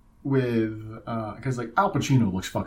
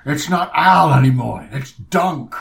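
loud, higher hammed-up star voice; Al Pacino; 4 semitones higher, 9 dB louder than usual. Mocking the actor's silly ad, loud hammy impression. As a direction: belt it out as a bombastic parody. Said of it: "in the Al Pacino voice".

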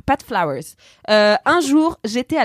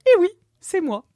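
amused reply; 10 semitones higher than usual. Retold or imitated. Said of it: "je l'entends exploser de rire en répondant".

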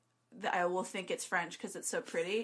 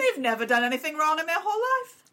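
louder, higher put-on outrage; posh mock Britain; 9 semitones higher, 7 dB louder than usual. Playing Britain defending Poland, loud high put-on voice. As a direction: defend Poland like a scandalized aristocrat.

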